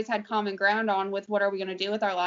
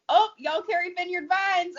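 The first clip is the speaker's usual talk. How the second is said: imitating a Southern customer; 9 semitones higher than usual. Retelling the store customer, mock Southern voice. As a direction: play the customer with a higher southern lilt. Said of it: "he was like".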